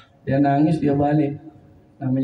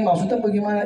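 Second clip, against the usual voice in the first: ordinary talk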